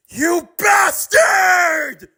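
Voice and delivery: high drawn-out enraged yell; furious shout. Acted. Miles charging Mahito, yelling in fury. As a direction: roar it as you charge.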